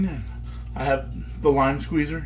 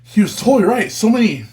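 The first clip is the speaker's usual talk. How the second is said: loud delighted ooh; delighted tasting; 5 semitones higher, 5 dB louder than usual. Real, not acted. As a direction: savor it with delight. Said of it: "mouth happiness".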